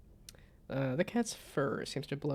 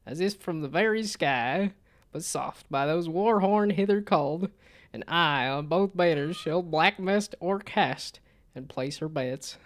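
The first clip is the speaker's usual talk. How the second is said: louder, archaic proclaiming, slow at the end; dramatic character voice; 3 semitones higher, 6 dB louder than usual. Acted. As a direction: declaim like a battle herald. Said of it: "It's the wrong voice".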